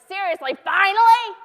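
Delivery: God finally losing patience with a late son; exasperated God. Retold or imitated. God shouting at Jesus in loud exasperation. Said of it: "God is like".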